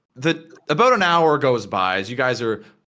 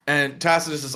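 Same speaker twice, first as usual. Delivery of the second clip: ordinary talk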